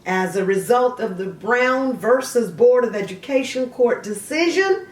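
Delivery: a bright encyclopedia read; reading a biography entry aloud; reading aloud from Wikipedia. Acted.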